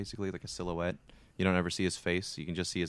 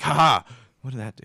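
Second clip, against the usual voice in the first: mocking triumph; 5 semitones higher, 10 dB louder than usual. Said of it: "you're like".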